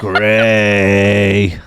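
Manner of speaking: long, loud bellowed Gray; drill-instructor yell. Retold or imitated. Drill instructor calling his recruit in the barbershop, drawn-out yell. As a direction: bellow the surname and hold it.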